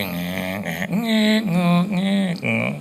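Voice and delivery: "ngek" noises jumping up in pitch; imitated trudging squeak. Couple walking down on foot, squeaky mimed trudging. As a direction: squeak out each weary step.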